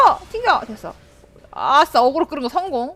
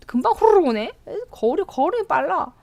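usual speaking voice

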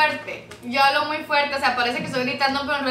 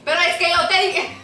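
mock angry; 4 semitones higher, 3 dB louder than usual. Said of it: "de chamuco adentro".

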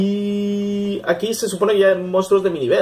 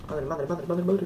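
tense muttering; 11 dB quieter than usual. Real, not acted. Tense dodge of fireballs.

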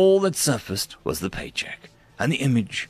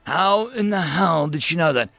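rattled outburst; 7 semitones higher, 3 dB louder than usual. Acted. Rattled guard's reaction to prisoner.

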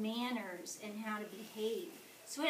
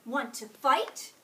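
louder, slow taunt; grouchy challenge; 2 semitones higher, 9 dB louder than usual. A narrator's reading. Grouchy ladybug dares the yellow jacket, slow and loud. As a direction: dare them to fight.

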